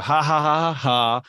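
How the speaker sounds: spoken, playful ha-ha; mock laughter, word by word; put-on laugh. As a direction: laugh along with the teasing. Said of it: "Some people might make fun of you".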